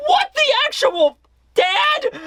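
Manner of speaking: very high, slow mock wail; Yamato's tearful outrage. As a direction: wail it in disbelief at your dad. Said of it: "Yamato is crying".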